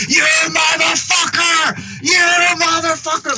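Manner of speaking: high, strained, drawn-out mock yell. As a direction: imitate a far-off furious yell, stretching each word. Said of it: "Like, yelling at me".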